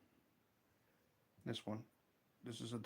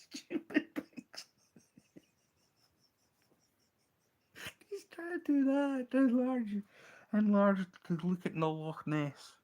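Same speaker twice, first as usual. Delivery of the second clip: high, helpless real laughing fit; talking through laughter; 10 semitones higher, 6 dB louder than usual. Real, not acted. Telling how a friend tried enlarging a map, laughing helplessly. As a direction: squeeze the words out through laughter. Cracking up while retelling story.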